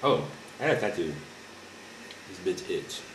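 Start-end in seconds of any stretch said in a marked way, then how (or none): none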